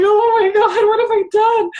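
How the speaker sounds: a hammed-up gasp at her own overbooking; mock panic.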